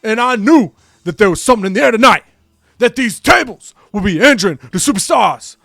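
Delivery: over-the-top shouted wrestler promo; Scott Steiner promo. Retold or imitated. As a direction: yell it like an unhinged heel on the mic. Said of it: "Scott Steiner comes out and says".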